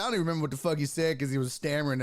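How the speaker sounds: usual speaking voice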